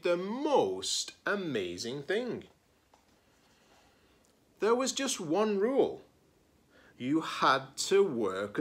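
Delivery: lively picture-book read-aloud; story narration. Acted. Librarian reading a picture book to her students, expressive storytelling. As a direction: read it like story time for kids.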